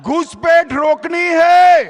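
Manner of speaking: loud rally slogan; rally shout. Real, not acted. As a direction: call it out to the crowd. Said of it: "जोर से बोलिए".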